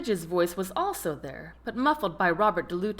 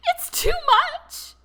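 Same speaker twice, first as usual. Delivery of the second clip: very high, louder, slow squeak through laughter; 9 dB louder, slower than usual. A narrator's reading. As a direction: squeeze it out, helpless with laughter. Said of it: "he squeaked out".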